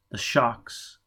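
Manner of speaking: plain reading voice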